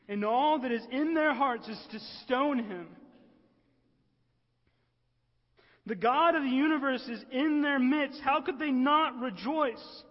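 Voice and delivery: loud, high, incredulous outcry; impassioned preaching. Real, not acted. Preacher cries out at their rejection.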